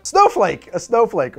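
word found. Real, not acted. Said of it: "I have a proper noun, aphasia".

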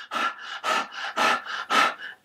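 demonstrative heavy puffing; out-of-breath panting. Shown as a demonstration. Teacher acting out being winded from the stairs, out-of-breath panting. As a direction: pant in short, voiceless bursts. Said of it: "I'm completely out of breath".

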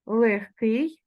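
легкий said with a voiceless kh, lekhkyi; devoiced h pronunciation. Shown as a demonstration. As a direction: say легкий as heard, kh not h. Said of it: "вимовляємо".

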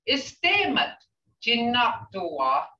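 teacher modelling the tones of "Istemat jinaktua"; tone and accent. Shown as a demonstration. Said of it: "you gotta put that tone in there".

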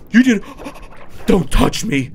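imitated appalled wife pulling away; shocked recoil. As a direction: gasp the words out in mock shock, broken by a pause. Acting Lori recoiling in shock.

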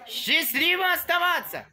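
high, loud goodbye; bright shouted goodbye; cheerful farewell shout. Real, not acted. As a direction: call the farewell out the window.

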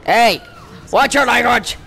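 loud, high telling-off; mock scolding. Player scolding game character for swearing, loud mock telling-off.

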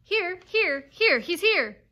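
high, loud, urgent shout; loud calling out. A narrator's reading. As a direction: call out loudly to the whole pack. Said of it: "calling in a deep, loud voice".

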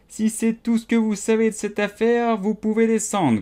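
a king sending the hatter away; haughty king. A narrator's reading. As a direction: dismiss him like an impatient king.

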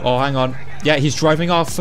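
ordinary talk, nothing marked

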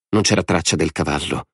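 plain reading voice

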